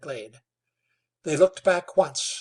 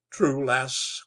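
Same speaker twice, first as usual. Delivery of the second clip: ordinary delivery, nothing marked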